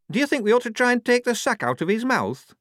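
high-pitched, nervous question. A narrator's reading. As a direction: ask timidly in a high little voice. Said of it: "said Shufti nervously".

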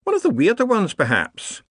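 high, quizzical wizard; wondering wizard. A narrator's reading. Another wizard speaking.

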